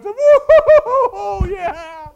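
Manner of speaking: joyful whoop. Acted. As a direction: whoop it out in relief and joy. Abraham's joy imagined.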